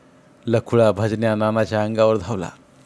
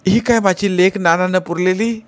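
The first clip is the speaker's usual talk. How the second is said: high, wailing accusation; 8 semitones higher, 3 dB louder than usual. Acted. Madwoman shows the skull.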